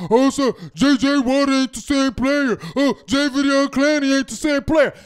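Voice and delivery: a light mock of a fan's comment; mocking the critics. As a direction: parrot the doubters with a sneer. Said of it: "if you write all through the week".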